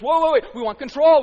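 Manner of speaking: loud, startled plea for control; mock panic demanding control; mock alarm. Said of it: "and everybody goes".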